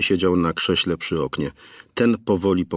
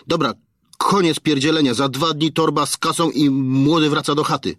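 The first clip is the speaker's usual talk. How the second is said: crude threatening thug, pitched higher, halting on words; thuggish kidnapper; 7 semitones higher than usual. Acted. Kidnapper dictating the ransom to the father, crude and threatening. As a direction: lay out the ransom terms like a street thug.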